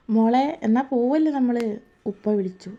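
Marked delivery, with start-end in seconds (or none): 0.1-1.6 higher, louder call from afar. Acted. Father calling his daughter to leave, raised and loud. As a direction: call her to come along now.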